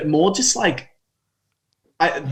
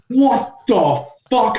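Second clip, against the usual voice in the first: drawn-out, higher-pitched outrage; furious reaction; 7 semitones higher, slower than usual. Retold or imitated. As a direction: retell a furious "what the" moment, slowly. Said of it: "I was furious".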